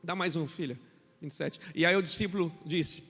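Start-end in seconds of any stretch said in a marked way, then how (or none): none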